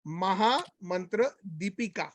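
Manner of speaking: each syllable spelled out carefully; slow, over-enunciated dictation; said slowly. Shown as a demonstration. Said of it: "I will say it slowly".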